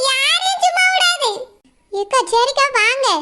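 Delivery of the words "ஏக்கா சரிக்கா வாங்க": lower, quicker neighbour woman cutting in; a worried neighbour breaking up the fight. Acted. Neighbour leads her off. (சண்டையை நிறுத்த வரும் பக்கத்து வீட்டுப் பெண், தாழ்ந்த குரலில்)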